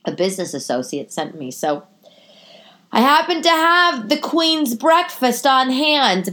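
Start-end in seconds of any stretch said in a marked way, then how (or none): 2.9-6.3 mock posh. Posh joke about Queen's breakfast.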